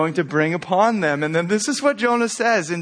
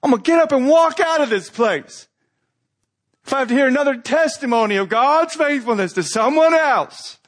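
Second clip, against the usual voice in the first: higher, fed-up grumble in your heart; exasperated inner voice; 8 semitones higher than usual. Retold or imitated. Listener fumes silently during testimonies, higher and exasperated. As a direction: roll your eyes at yet another testimony. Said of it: "you're going".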